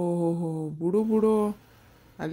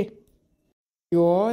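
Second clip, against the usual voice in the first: wail; 4 semitones lower, 4 dB louder, much slower than usual. Acted. Friend wails over his girlfriend.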